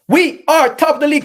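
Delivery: high, loud victory yell; triumphant shout. Real, not acted. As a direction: belt it like a terrace chant. Fan chant to stream chat.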